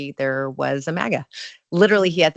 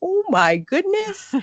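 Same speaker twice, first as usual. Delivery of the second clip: high, slow gasp of amazement; astonished; 3 dB louder, slower than usual. Retold or imitated. Amazed that the maga guest agreed, astonished. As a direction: stretch it out in shock. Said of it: "And I went".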